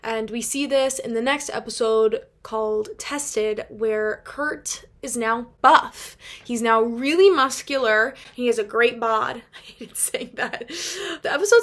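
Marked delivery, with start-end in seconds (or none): none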